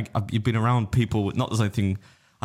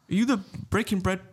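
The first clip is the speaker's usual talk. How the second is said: voiced stranger; 10 semitones higher than usual. Stranger voiced in retold story.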